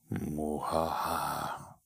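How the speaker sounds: slow mock evil laugh. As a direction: cackle like a cartoon villain. Host's villain laugh.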